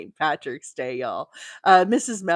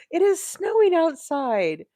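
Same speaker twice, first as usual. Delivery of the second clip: high astonished exclaim; 10 semitones higher than usual. Real, not acted. Crocheter telling viewers about surprise snow, astonished high exclaim. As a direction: pitch jumps up, "snowing" stretched in disbelief. Repeating the shocking snow news.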